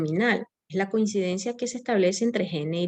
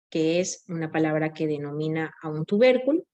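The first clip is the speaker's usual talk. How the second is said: ordinary talk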